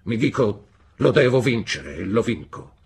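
loud, forceful stepfather's threat; menacing threat. A narrator's reading. Stepfather tells David how he breaks stubborn animals, menacingly. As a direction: hammer each threat home, loud and relentless.

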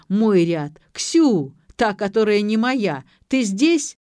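gladdened. A narrator's reading. Young man calls for Ksyu in the dark, gladdened. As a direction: call out cheerfully for someone. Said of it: "обрадовался негр".

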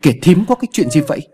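sudden, fast, high alarmed ask; startled question. Acted. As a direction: blurt it out in alarm. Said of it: "Ông bất thần hỏi".

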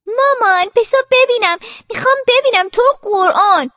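high, loud whine to mum; insistent childish plea; pleading child. Acted. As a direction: beg insistently like a little girl. Child begs mother to let her look.

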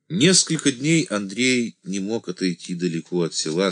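ordinary delivery

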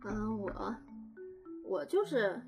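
ordinary delivery

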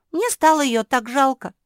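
high, loud plaintive wail; tearful pity. A narrator's reading. Жозефина recalling her pity for Куки, tearful. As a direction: lament as if near tears. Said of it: "шмыгнула носом Жозефина".

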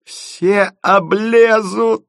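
very slow, high-pitched squeal; convulsed with mirth. A narrator's reading. Drunk friend predicts disaster, in convulsions. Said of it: "в конвульсиях проговорил Лёлик".

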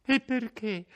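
high, loud, trembling question; fearful indignation. A narrator's reading. Lucia challenges her captor, afraid but indignant. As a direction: fear edged with desperate indignation. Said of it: "col tremito della paura".

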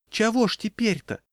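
put-on high, piping old-woman plea; plaintive old woman. A narrator's reading. Old woman calling from the thick of the crowd, plaintive. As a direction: squeak it pitifully. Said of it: "жалобно пискнула из гущи её какая-то старушка".

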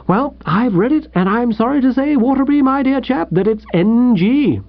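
high-pitched, blithe editor voice; breezy editor. Acted. Editor rejects his old friend's article, breezily. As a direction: turn it down airily in a high voice.

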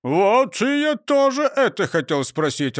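high voice calling back; answering voice. A narrator's reading. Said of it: "раздалось в ответ".

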